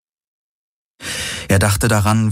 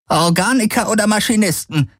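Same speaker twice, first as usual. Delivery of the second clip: agitated. Acted. Kessli asks who it is, high and agitated. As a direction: worry pushing the pitch up.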